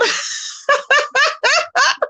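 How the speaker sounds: a high, helpless laugh; high burst of laughter. Real, not acted. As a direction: crack up at your own joke. Cracking up at own joke.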